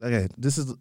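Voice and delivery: usual speaking voice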